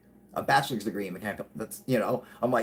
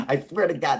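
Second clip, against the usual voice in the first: normal talking voice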